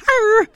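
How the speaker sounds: high-pitched mock tiger growl; bad tiger impersonation. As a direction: give a playful, badly faked tiger roar. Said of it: "that's a bad impersonation of a tiger".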